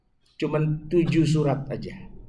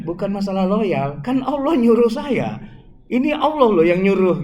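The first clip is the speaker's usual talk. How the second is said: firm insisting; 5 semitones higher, 4 dB louder than usual. Acted.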